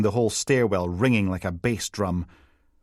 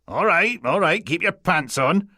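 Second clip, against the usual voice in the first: dismissive; 8 semitones higher, 4 dB louder than usual. A narrator's reading. Steele brushing off impatience.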